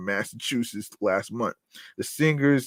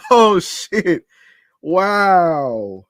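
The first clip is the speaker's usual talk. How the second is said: amused amazement; 7 semitones higher, 9 dB louder, much slower than usual. Real, not acted. Recovering from laughing at memes.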